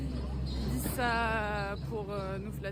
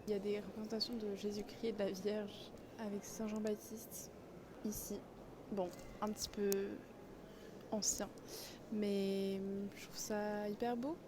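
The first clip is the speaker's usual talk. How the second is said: very soft, discreet voice; hushed; 13 dB quieter than usual. Real, not acted. Visitor filming inside a mosque, hushed.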